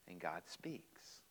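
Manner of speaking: slow, soft and hushed; slow hushed line; hushed reverence. Acted. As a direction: let the words fall almost silent in awe. Climax of Samuel story.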